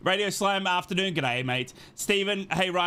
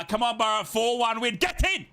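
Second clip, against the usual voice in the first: raised pitch, exploding high on the last words; rallying cheer; 7 semitones higher than usual. Retold or imitated. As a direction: read it like a fan's chant, building to a shout. Cheering out a fan's chat message.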